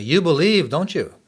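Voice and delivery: high, loud challenge shouted up through the cell block; retold yell. Prisoner called Satan yells up at the narrator, loud challenge. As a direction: bellow it up at him as a question. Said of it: "And he yells back at me".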